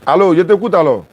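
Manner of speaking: high and loud, pushing over the line; loud on the phone. Real, not acted. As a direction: speak up to a caller. Parle fort au téléphone. (voix forte au téléphone)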